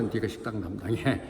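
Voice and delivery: low, soft acted order; understated, hushed comeback; deadpan assigning. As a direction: quietly hand the grumbler the job. Answer to a complainer.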